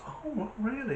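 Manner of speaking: high, soft, taken aback; softly incredulous, pitch jumping up; acted surprise.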